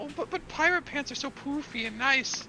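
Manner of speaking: high, pleading recruit voice; eager pirate recruit. Acted. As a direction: plead like a keen would-be pirate.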